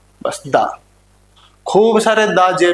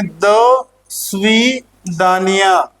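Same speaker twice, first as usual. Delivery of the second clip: दसवीं दानिया stretched slowly, piece by piece; syllable by syllable; much slower than usual. Shown as a demonstration. Teacher reading the Russian goodbye with the class, दसवीं दानिया slowly split up. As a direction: break दसवीं दानिया into slow, drawn-out parts. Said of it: "इसको साथ में पढ़ेंगे".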